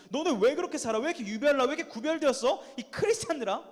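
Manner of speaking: high, loud jeering questions; mocking. Preacher voicing those who mocked the early church, mocking. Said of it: "라고 조롱했던 말이에요".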